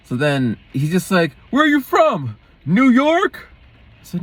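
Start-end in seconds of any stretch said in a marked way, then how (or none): 1.5-3.5 high, loud mock challenge; put-on high-pitched holler; imitated shouting. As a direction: mimic an angry man yelling questions. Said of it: "why you're shouting at me".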